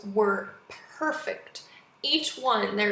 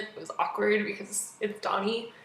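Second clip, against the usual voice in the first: usual speaking voice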